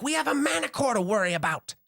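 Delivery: high, loud commanding voice; stern commander. Acted. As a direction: lay down the law to your men. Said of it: "He's like".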